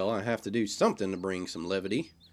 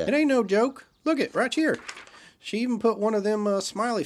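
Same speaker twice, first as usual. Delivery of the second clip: high and loud, eager to convince; blurting it out, worked up; excited; 11 semitones higher, 4 dB louder than usual. Acted. Insisting the message is real.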